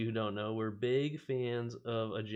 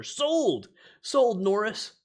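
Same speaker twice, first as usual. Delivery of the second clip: high, loud buyer's cry; eager exclamation; 9 semitones higher, 8 dB louder, much slower than usual. Pretend. Streamer playfully answering the cobbler on the card, eager shout. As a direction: call it out like an eager customer. Buying the boots from card's cobbler.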